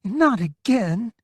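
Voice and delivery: winded, exasperated cry; out of breath. A narrator's reading. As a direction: gasp it out after a trot. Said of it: "she sounded out of breath".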